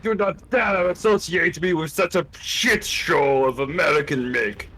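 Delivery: stiff put-on German accent; clipped fake German officer; hammed-up German accent; mock German accent.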